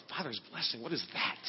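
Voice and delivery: soft, puzzled half-whisper to himself; a confused mutter over his burrito; baffled murmur. Retold or imitated. Baffled reply to God's words.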